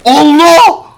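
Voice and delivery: loud high startled cry; startled shout. Real, not acted. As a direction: cry out in surprise. Startled exclamation.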